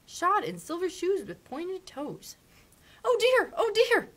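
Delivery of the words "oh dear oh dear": high, loud cry of dismay; Dorothy cries out in alarm; a little girl wails in dismay at the dead witch. A narrator's reading. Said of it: "cried Dorothy".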